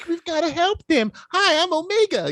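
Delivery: put-on very high chirpy little girl voice; squeaky eager kid impression. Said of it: "she's very".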